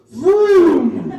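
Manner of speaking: put-on loud high revving vroom, like a kid; mock engine vroom. Comic mocking the injured cyclist, loud vroom. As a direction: rev it up gleefully. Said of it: "Oh, in that case".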